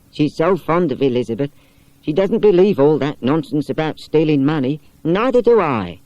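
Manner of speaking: put-on a companion politely turning boys away; Miss Wardle. Miss Wardle defends Elizabeth.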